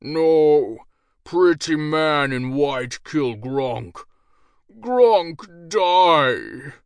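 loud, slow, hammed-up brute dying; ogre death scene. A narrator's reading. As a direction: overplay a mock death. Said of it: "Gronk switched to his original voice".

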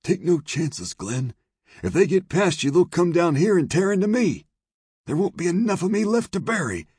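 high, loud, panicky character voice; frightened saloon owner. Acted. Saloon owner begs marshal for caution, loud and panicky.

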